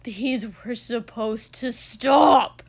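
slow, loud anguished shout; raging cry drawn out on the last word; livid, almost pleading. A narrator's reading. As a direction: shout it as a furious plea. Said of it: "it almost sounded like he was pleading".

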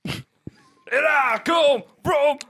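slow, high rock-singer wail. Acted. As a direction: cry it out in a drawn-out mock metal howl. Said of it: "I'm gonna be [name]".

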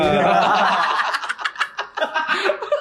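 bursting out laughing; big hearty laugh; loud laugh. Real, not acted. (tertawa lepas)

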